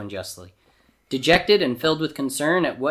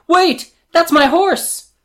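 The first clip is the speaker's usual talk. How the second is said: high, loud protesting shout; calling out; 9 dB louder, slower than usual. A narrator's reading. Owner calling down the hill to Jafar, shouting. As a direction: shout it across a distance, pitched way up. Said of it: "raise your hand and call out".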